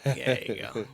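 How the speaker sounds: ordinary delivery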